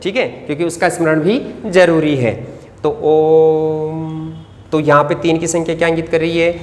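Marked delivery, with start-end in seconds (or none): 3.0-4.1 ओम slowly chanted, the vowel held long; long drawn ओम. Shown as a demonstration. Teacher demonstrating a pluta ओम. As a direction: stretch ओम out slowly. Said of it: "द्विमात्रिक से भी ज़्यादा लंबे समय तक".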